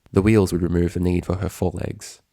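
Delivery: ordinary delivery, nothing marked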